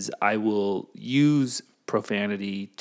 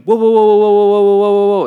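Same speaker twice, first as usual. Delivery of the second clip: loud, high calls of whoa to stop the fight; urgent whoa; 11 semitones higher, 14 dB louder than usual. Pretend. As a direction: throw up the brakes, loud and high.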